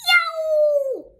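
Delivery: very high, loud yelp; frightened shriek; startled yelp. A narrator's reading. Class shrieks at pigeon.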